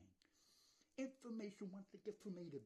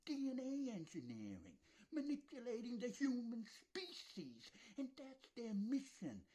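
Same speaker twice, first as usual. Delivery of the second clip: loud rant; 7 semitones higher, 5 dB louder than usual. Real, not acted. Ranting about manipulation.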